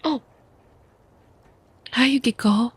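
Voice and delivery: ordinary delivery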